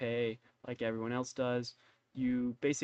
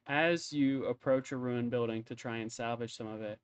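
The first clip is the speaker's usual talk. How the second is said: ordinary delivery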